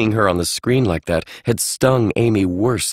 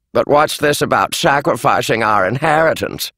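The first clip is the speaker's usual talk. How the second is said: a shrill-toned complaining aunt, higher; 4 semitones higher than usual. Acted. Aunt Beatrice complaining about the inheritance, higher. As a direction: whine about the money. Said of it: "Aunt Beatrice complained".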